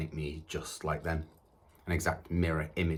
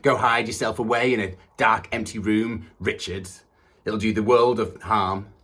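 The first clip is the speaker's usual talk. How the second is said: loud, raised scolding; forceful, higher parental reproach; parent berating son; 4 semitones higher, 8 dB louder than usual. Acted. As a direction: throw it out as a parent's harsh order. Voices harsh parents in monologue.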